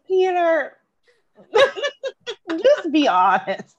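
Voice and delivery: begging Peter through laughter; laughing plea. Retold or imitated. Friend laughing while pleading with Peter to just be honest. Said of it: "I'm like".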